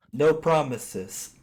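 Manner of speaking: slow, loud defiant call; shouted taunt. Acted. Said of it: "gonna shout to him".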